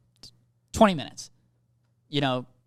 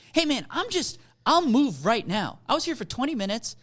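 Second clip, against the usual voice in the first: high-pitched, retold appeasing plea; placating plea; 6 semitones higher than usual. Driver telling a threatening man he'll move, placating. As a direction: talk him down, pitched up and placating. Retold attempt to defuse parking row.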